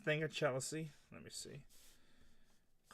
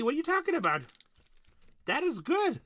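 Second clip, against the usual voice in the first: much higher, louder, in a squeaky mock voice; mock squeaky praise; 12 semitones higher, 8 dB louder than usual. Mock praise to chat viewer.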